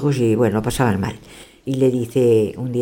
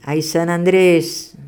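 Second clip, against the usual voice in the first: slow, louder sing-song rhyme; a slow, louder mock lament over the first plate; 4 semitones higher, 4 dB louder, much slower than usual. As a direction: drag out the rhyme like a sighing chant. Same rhyme third dish.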